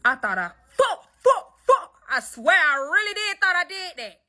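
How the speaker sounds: frantic, half sobbing; panicked. Real, not acted. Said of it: "I ain't gonna cap".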